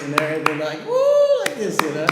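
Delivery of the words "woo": very high, loud whoop; piercing celebratory woo; crowd's cheering whoop. Retold or imitated. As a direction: imitate a carriage exploding in cheers. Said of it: "just explodes in applause".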